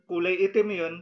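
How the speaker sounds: usual speaking voice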